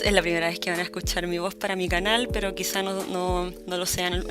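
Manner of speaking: normal talking voice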